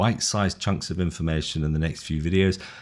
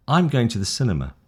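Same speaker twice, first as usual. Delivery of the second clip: "cinema" drops down at the end; falling statement tune; 3 semitones higher than usual. Shown as a demonstration. Teacher models a statement for intonation learners, falling statement tune. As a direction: state it, letting the pitch fall on "cinema". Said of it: "Down. You see the ending?".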